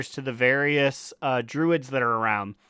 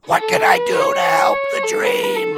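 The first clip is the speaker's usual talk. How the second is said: shrill squeaky NPC voice line; terrible game voice; 5 dB louder than usual. Retold or imitated. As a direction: squeal it high like a bad voice actor. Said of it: "Her voice is terrible".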